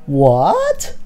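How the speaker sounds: drawn-out, sharply rising "What?"; shocked what. Acted. Told about the left foot on asphalt, shocked. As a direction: react in stunned disbelief. Startled by news about feet.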